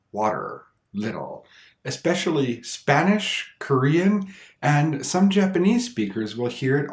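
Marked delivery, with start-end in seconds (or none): 0.1-1.5 "water, little" with each T a quick R-like tap; flap T like R. Shown as a demonstration. Teacher showing learners the R-like flap T in "water, little". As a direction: say "water, little" softly, tongue just flicking the T. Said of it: "it might sound more like an R".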